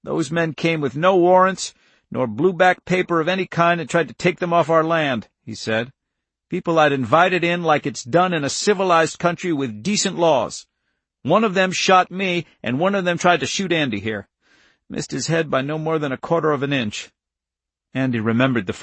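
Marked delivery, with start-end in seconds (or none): 0.0-5.3 put-on higher, emphatic older-farmer protest; indignant countryman. Irv protests the men on his land, indignant. Irv's angry account in the story. 6.5-17.1 put-on higher, emphatic older-farmer protest; indignant countryman. Irv protests the men on his land, indignant. Irv's angry account in the story.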